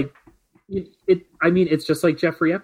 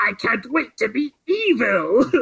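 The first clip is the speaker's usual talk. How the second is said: cackling villain; 8 semitones higher than usual. Retold or imitated. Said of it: "a cackling guy going".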